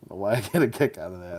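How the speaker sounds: usual speaking voice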